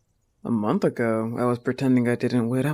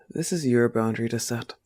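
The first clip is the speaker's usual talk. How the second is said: normal talking voice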